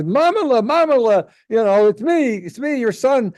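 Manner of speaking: high, loud mock son calling his mother; son's voice. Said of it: "comes over and says".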